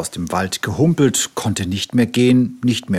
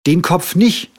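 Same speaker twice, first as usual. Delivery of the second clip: higher commanding cub voice; bossy little tiger; 9 semitones higher, 5 dB louder than usual. A narrator's reading. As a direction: order the doctor around. Tiger refuses head bandage.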